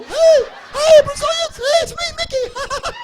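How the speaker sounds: very high squeaky cartoon mouse voice; Mickey Mouse voice. Comedian voices Mickey Mouse greeting.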